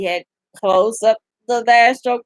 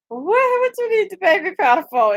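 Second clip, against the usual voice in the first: mock incredulous question; 9 semitones higher, faster than usual. Mocking someone's shocked question.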